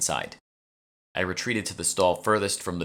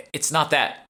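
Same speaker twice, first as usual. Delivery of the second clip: urgent interjection; 10 semitones higher, 5 dB louder, slower than usual. Acted. Urgent interjection, faking sickness.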